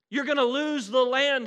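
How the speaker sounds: preached warning at full force; loud warning. Acted. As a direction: thunder it out as God's threat. God's warning voiced in sermon.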